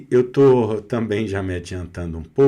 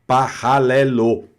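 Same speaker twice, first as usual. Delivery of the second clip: paralelo with a throaty French R, louder and slow; French reading; 6 dB louder than usual. Shown as a demonstration. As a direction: read paralelo like a French speaker. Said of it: "Ela vai ler". (paralelo lido à francesa, R na garganta)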